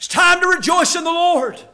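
preacher lifting the same words into a call; preaching proclamation. Acted. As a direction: proclaim it to the whole room. Preached right after the paraphrase.

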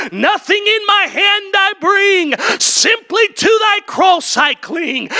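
rising to a high shouted hymn line; full-force preaching. Acted. Preacher crying out the hymn to the congregation. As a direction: preach it up to the rafters. Preaching builds to Rock of Ages.

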